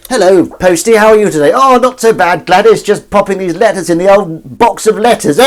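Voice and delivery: loud, high-pitched caricature of village small talk; hammed-up cheery neighbours chatting at the postbox; chirpy village characters. Villagers greet the postie in a nineteen forties film, hammed-up chirpy.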